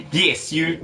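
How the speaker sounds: high mock giggle into the words; put-on giggly delight; Renico giggling. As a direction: imitate a gleeful, tittering friend. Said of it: "Renico's like".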